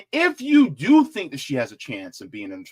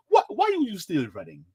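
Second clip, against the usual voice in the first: put-on African accent, higher pitch; best African accent; 4 semitones higher than usual. Asking Nikki why she's still running, in an African accent. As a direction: ask it in a mock African accent. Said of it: "in my best African accent".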